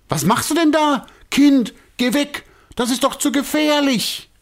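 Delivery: high, loud alarmed call; sudden call. A narrator's reading. Director calling the girl away from the cage, loud and alarmed. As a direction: shout her away from danger. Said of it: "ruft Herr Alasi plötzlich".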